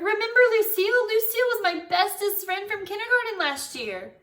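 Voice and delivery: high, loud, gushing rush; excited holler. A narrator's reading. Girl reminds Daddy about best friend, excited holler. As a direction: burst out with happy news. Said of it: "I hollered".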